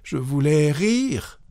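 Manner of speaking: high, slow, effortful excuse; pained, laboured. A narrator's reading. Wife struggling to excuse her confession, pained. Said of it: "péniblement".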